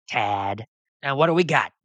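ordinary talk, nothing marked

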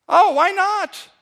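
ordinary talk, nothing marked

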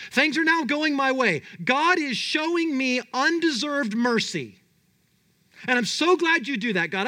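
preacher playing a gleeful Jonah. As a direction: gloat like a spoiled prophet.

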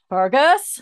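high, loud call with a questioning rise; mock urgent call for a husband. Wife calls Fergus as a contraction hits, calling out.

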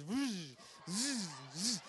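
buzzing "vzz" of line running out; imitated fishing reel whizz. Storyteller imitating the rod as fish bites, buzzing whizz. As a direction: mimic a rod whirring in short bursts. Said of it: "his rod goes".

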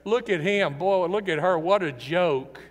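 scornful ridicule from a former friend; mocking taunt. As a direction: sneer it at the fallen friend. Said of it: "They mock me. They laugh at me".

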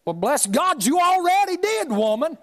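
mock indignant comeback; comic retort. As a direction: fire back at mama, half laughing.

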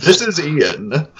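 slow, put-on podcast host voice; hammy radio voice; Clint McElroy. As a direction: draw it out like a radio host introducing himself. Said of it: "like, Clint McElroy podcast voice".

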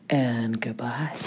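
soft breathy whisper; whispered goodbye. Acted. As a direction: whisper it gently. Sign-off.

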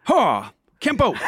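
high, punchy karate kiai; martial arts yell. Retold or imitated. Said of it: "he was like".

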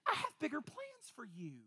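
soft, gentle fatherly voice; gently going. Retold or imitated. The king gently speaking to a struggling believer, soft. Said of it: "the king is sitting there so gently going".

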